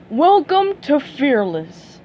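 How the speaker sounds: slow, booming TV announcer voice; announcer greeting the contestants; game show host. Acted. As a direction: welcome the audience like a showman.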